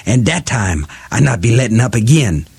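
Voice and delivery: snarling through clenched teeth; muffled voice. Acted. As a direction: threaten through a rope bite. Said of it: "she said in a muffled voice".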